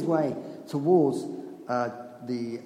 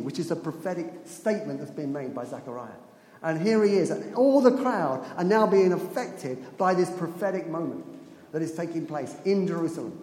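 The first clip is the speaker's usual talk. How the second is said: normal talking voice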